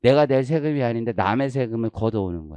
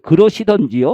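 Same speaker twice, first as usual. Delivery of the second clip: louder sulky retort; 5 semitones higher, 8 dB louder than usual. Retold or imitated. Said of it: "그 순간에 폭발했어요".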